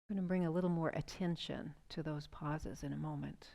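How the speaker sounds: soothing breathwork guide; guided breathing meditation. Acted. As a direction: ease them into the breath, unhurried. Leads a guided breathing exercise.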